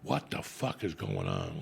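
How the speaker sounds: low, slow disbelief; baffled inner voice. Retold or imitated. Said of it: "I'm like".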